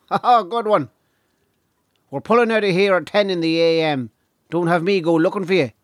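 high-pitched boss laying down the rules; tour manager, amused then stern. Acted.

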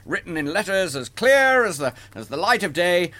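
jolly Santa announcing the gift; Santa's big build-up. Shown as a demonstration. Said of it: "so huge build-up".

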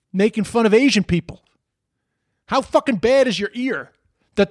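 high-pitched, exasperated ranting; incredulous. Real, not acted. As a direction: demand an answer in sheer disbelief. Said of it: "I just couldn't fucking believe it".

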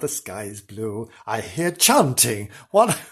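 ordinary talk, nothing marked

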